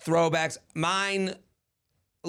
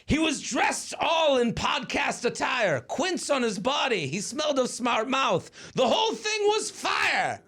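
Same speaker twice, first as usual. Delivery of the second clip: excited; 8 semitones higher, slower than usual. Acted. Excited poem lines.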